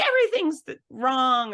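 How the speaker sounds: high, whiny mock wail; mocking dramatic people. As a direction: squeal it like an overdramatic complainer. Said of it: "people are like".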